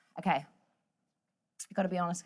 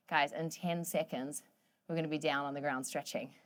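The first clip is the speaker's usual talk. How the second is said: soft encouragement; 6 semitones lower, 5 dB quieter than usual. Real, not acted. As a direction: ease them through the last seconds. Coach eases toward the end.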